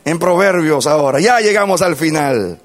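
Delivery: loud, high-pitched sermon delivery; full-force preaching. Real, not acted. Preacher tells the congregation the conclusion, preaching at full force. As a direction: preach it with force to a big crowd.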